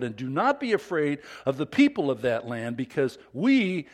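loud, emphatic scripture reading of the spies' report; rousing the assembly, pressing each line home; read with emphasis. Acted. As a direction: urge the crowd on with every phrase. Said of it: "I read it with emphasis".

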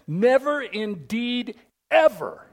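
"Never" punched high and loud, "ever" hit hard; emphatic never. Real, not acted. Preacher pressing the promise on his church, stressing never and ever. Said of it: "meant to be emphatic".

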